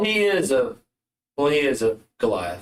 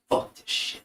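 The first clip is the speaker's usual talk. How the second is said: muttered, barely voiced; mumbled under his breath; 7 dB quieter than usual. Envoy mumbles to himself before his ultimatum, muttering. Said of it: "you hear him mumble under his voice".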